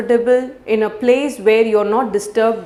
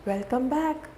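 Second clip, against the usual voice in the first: softer, unhurried meditation voice with long pauses; guided meditation; 12 dB quieter than usual. Real, not acted. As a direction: guide the listener gently through each breath.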